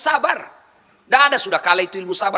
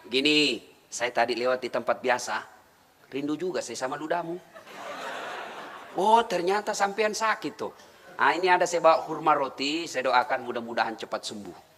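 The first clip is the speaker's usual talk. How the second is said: soft, much quieter, tender words; gentle visit; 8 semitones lower, 8 dB quieter than usual. Acted. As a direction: speak gently to a sick man. Prophet gently visits sick enemy. (suara lembut penuh kasih)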